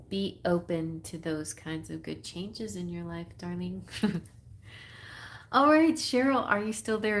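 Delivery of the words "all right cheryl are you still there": high and loud, calling her name. Real, not acted. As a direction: call out to check she's still there. Calling to a sitter who went quiet.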